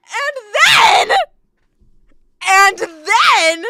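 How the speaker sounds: high, loud words breaking into laughter; can barely get it out for laughing; laughing fit. Real, not acted. Streamer cracking up telling chat the story, high and loud.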